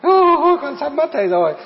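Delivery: mock wail rising to a high, loud cry; imitated crying. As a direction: act out a woman wailing for her teacher. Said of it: "Cho nên lên bà khóc".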